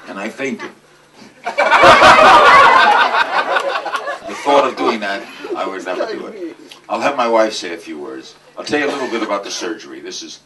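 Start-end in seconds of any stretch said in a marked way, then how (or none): 1.2-4.2 laughter. Real, not acted. Audience laughing at the story.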